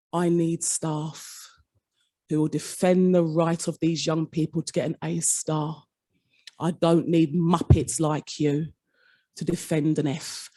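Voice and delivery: quiet threat, each word weighed; sinister quiet voice. Retold or imitated. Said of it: "sinister, quiet voice".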